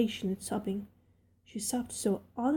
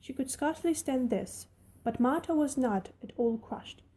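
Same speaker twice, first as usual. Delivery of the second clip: ordinary delivery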